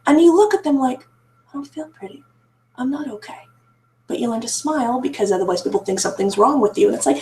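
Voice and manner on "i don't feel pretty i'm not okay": barely audible, much softer; whisper-soft, as if only to herself; hushed inner voice. Retold or imitated. Answering relatives' compliments, she says it much softer, barely audible.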